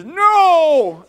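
put-on high, loud defiant shout of a little boy; angry child's no. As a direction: yell it back like a furious toddler. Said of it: "He was mad".

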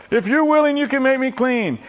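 high, loud mock plea; imitating a pleading leper. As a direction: beg hammily to be healed. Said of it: "He said".

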